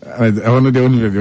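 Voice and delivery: ordinary delivery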